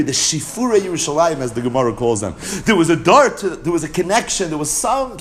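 usual speaking voice

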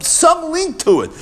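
long, high stretched phrase; soaring slow emphasis; drawn-out emphasis. Real, not acted. Emphatic drawn-out peak of explanation.

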